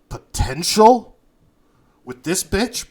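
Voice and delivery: high-pitched, incredulous questions; scornful disbelief. Acted. Ironclad dismissing Bruce's crew, in scornful disbelief. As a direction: scoff loudly, pitch jumping up on each question. Thug scoffs at the newcomers.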